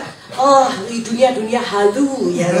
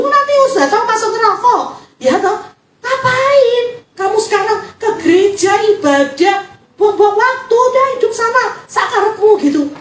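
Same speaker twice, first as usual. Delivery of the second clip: mock exasperation; 10 semitones higher, 4 dB louder than usual. Mocking the unforgiving churchgoer.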